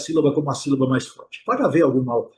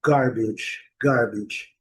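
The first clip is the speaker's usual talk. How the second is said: American "garbage" with the R sounded; American model "garbage", R curled before the B; American English; 4 semitones lower, much slower than usual. Shown as a demonstration. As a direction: say "garbage" as GAR-bidge, American R. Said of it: "E o inglês americano".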